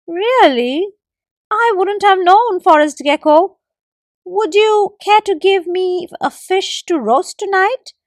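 hint for a fish; squirrel suggesting. A narrator's reading. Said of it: "suggested the squirrel".

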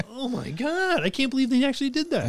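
real astonished disbelief; incredulous. Real, not acted. Disbelief at friend's licence trick.

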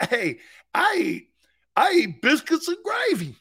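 high, loud hammed-up Southern twang; mock Southern boast. Coach boasting of Southern food in a hammed-up Southern accent. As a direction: brag about biscuits in a fake Southern accent. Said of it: "I'm a Southerner now".

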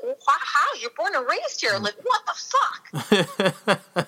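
high, laughing disbelief at Koreatown; incredulous rant. Retold or imitated. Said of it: "I'm like".

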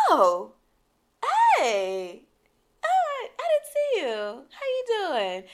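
high, fast fake surprise; fake surprised greeting. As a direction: pretend you just noticed him. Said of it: "And I was like".

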